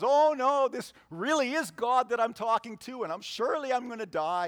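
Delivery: sudden high, loud panicked cry; freaked out. Retold or imitated. Gideon terrified after seeing the fire, a panicky yelp. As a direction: panic that you've seen God. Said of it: "And Gideon freaks out".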